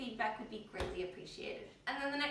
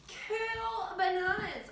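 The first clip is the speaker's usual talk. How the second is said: excited; 9 semitones higher, 7 dB louder, much slower than usual. Real, not acted. Cheery sign-off after smoothies.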